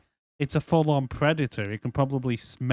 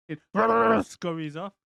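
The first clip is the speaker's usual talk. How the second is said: ordinary delivery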